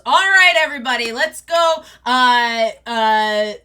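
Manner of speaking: high, loud, cheerful pack leader; rally the whole pack, big and loud; jolly wolf father calling everyone to go; booming wolf dad. Acted. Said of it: "you see Big Dad goes".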